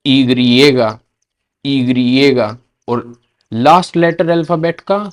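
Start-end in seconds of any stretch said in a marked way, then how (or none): 0.0-2.6 ईग्रिएगा as Spanish "i griega"; Spanish letter name. Shown as a demonstration. Teacher to students, careful Spanish letter name. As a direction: model ईग्रिएगा slowly. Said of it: "Y को".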